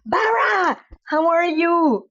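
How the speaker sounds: high, drawn-out happy call; excited greeting. Real, not acted. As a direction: call out the name with delight. Greeting a viewer, calling out.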